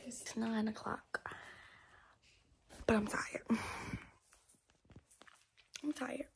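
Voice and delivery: sleepy hushed whisper. Real, not acted. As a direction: whisper it, worn out. Tired morning whisper.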